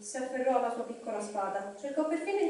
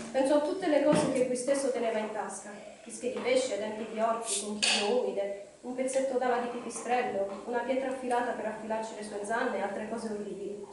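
ordinary delivery

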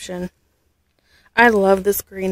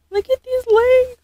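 a high squeal; delighted squeal; 14 semitones higher, 3 dB louder than usual. Real, not acted. Gushing over the baby's legs, a high squeal.

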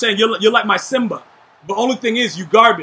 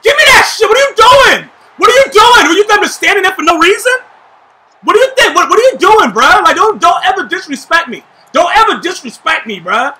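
angry shouting; 7 semitones higher, 6 dB louder than usual. Real, not acted. Raging at a teammate's play.

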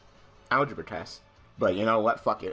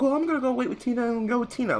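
very high, fast mimicking voice; imitated woman; 13 semitones higher, faster than usual. Classmate saying she'll wait with Tina, high hurried imitation. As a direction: quote her in a squeaky rush.

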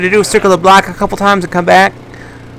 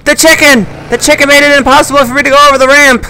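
high, fast frustrated shouting; 7 semitones higher, faster than usual. Real, not acted. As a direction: rage at the failed jump. Crash blamed on chicken.

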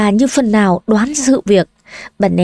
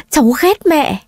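"cháu ghét mẹ" repeated higher, breaking; sobbing outburst; 7 semitones higher than usual. Acted. Granddaughter sobbing to grandmother, voice climbing higher. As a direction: let the second "ghét" climb higher in sobs. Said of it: "nấc lên từng tiếng".

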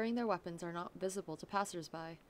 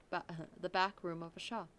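ordinary talk

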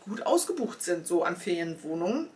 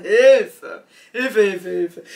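loud comic shout for help; mock cry for help; 12 dB louder, much slower than usual. To viewers about the hassle, loud mock cry for help.

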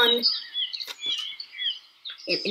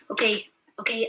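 usual speaking voice

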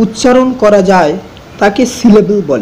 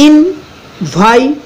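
Invitation very slowly, syllable by syllable, higher; split in four; 4 semitones higher, much slower than usual. Shown as a demonstration. Teacher easing pronunciation for students, invitation in four slow parts. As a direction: pause between ইন, ভাই, টে, শন in invitation. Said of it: "চারটে ভাগে ভাগ করছি".

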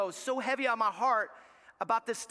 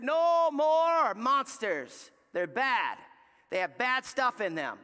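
loud, hugely drawn-out decree; booming slow parental ban; stern dad's ban; 3 dB louder, slower than usual. Retold or imitated. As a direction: lay down the law, stretching each word. Dad laying down a ban.

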